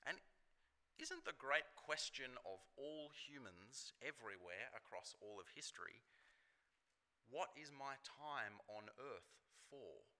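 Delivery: normal talking voice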